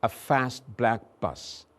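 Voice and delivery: "fast" with a flat American a, as in "back"; American English. Shown as a demonstration. Teacher contrasting British and American English. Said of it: "in American English".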